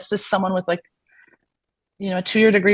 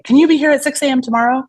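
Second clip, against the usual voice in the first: higher, louder and fast, breezy ask; mock chirpy request; 7 semitones higher, 5 dB louder, faster than usual. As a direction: imitate the clinic's cheery last-minute text. Reading out the boss's text.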